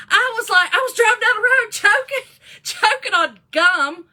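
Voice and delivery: high and loud, laughing through the words; breathless giggling story; laughing while telling. Real, not acted. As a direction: crack up as you relive choking on gum. Retelling choking on gum while driving.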